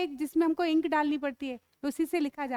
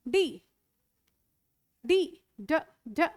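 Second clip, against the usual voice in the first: ordinary talk, nothing marked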